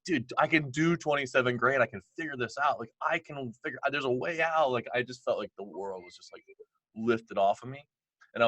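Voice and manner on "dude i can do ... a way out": elated, pitched higher, rushing with relief; fired up. Retold or imitated. Telling his wife he can pay the IRS, elated. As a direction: sound lit up and unstoppable. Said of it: "on fire because I was like".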